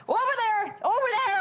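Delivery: high, loud urgent shout; acted panicky yell to direct someone; frantic calling out. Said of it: "I'm going".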